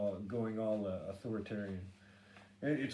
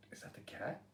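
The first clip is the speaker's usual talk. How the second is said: whisper; 2 semitones higher, 9 dB quieter than usual. Retold or imitated. Wondering about a noise at night.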